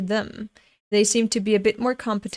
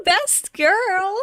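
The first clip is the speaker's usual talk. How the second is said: a squeaky high, louder cheer; gushing; 11 semitones higher, 5 dB louder than usual. Real, not acted. Reader cheering her favourite girl, high and excited.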